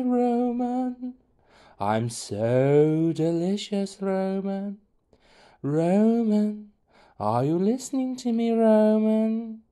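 high, slow, loud ghostly sing-song. Acted. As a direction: croon it like a haunting spirit. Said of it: "it sang out in a ghostly voice".

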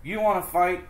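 high, loud, picking a fight; grouchy ladybug. Acted. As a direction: taunt them to fight. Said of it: "said the grouchy ladybug".

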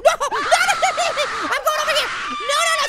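loud, high, frantic refusals; panicked. Real, not acted. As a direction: blurt it out while scrambling away. Fleeing monster in game.

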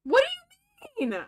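high, cracking disbelief; shocked. Real, not acted. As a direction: blurt it out stunned.

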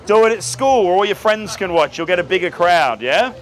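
ordinary delivery

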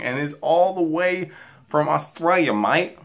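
badly faked Aussie accent; horrible Australian accent. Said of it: "That is my horrible, horrible accent".

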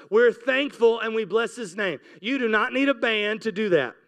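ordinary talk

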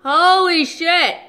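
loud, slow disgusted yell; shocked shout. Real, not acted. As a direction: shout it out after the sour hit. After chugging lemon juice.

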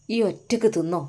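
ordinary talk, nothing marked

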